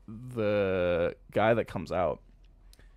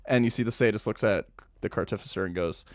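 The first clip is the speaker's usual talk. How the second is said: normal talking voice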